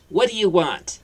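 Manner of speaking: high, loud hostile demand; rude snap. Acted. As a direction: snap at the stranger at the door. Aunt Petunia snaps at stranger.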